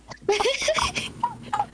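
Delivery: shrieking high laugh. Real, not acted. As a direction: cackle with delight. Laughing at the advice.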